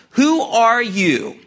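"Who are you?" drawn out slowly, stress on who; slow pointed question. Acted. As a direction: ask it point blank, slowly. Retells the point-blank question.

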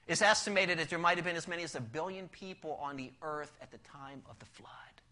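ordinary delivery

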